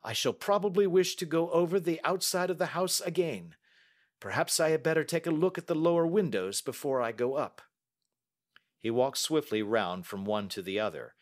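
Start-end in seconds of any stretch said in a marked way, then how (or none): 0.0-7.6 high, brisk detective's voice; Holmes. Acted. Detective saying he will look over the house, businesslike. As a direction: announce the plan with raised pitch. Holmes plans his search.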